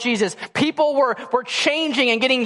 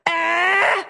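put-on a one-year-old demanding more, pitched way up; demanding baby; 7 semitones higher than usual. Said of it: "she looks at me and goes".

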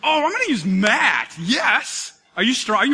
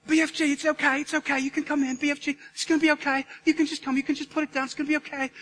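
high, softened little voice, coaxing; Sophie; 5 semitones higher, 3 dB quieter, faster than usual. Acted. Sophie reassuring the hiding giant it's okay, high coaxing voice. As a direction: gently coax in a raised, put-on voice. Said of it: "and Sophie's like".

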